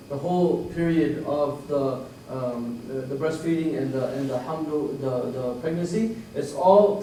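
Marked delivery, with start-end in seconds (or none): none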